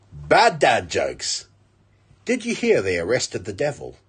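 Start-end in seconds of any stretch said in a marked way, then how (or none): 0.1-1.5 drawn-out, deadpan intro call; slow title announcement. Acted. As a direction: announce it slowly like a show title.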